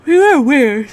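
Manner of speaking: yawned through the words; yawning. Real, not acted. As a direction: say it mid-yawn. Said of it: "Sorry, I just yawned".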